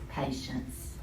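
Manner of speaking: usual speaking voice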